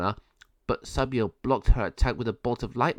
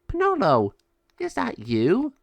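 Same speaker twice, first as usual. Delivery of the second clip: surprised; 10 semitones higher, 4 dB louder than usual. Acted. Surprised at seeing bird.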